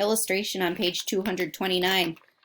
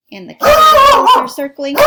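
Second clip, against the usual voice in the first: much higher, louder and faster, playing up the danger; dramatic spooky aside; 17 dB louder than usual. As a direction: shriek it out like a scary story twist. Dramatic aside about killer whales.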